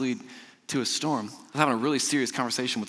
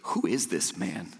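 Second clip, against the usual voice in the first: awed fear; 7 semitones lower, 4 dB quieter, slower than usual. A narrator's reading. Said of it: "absolutely terrified".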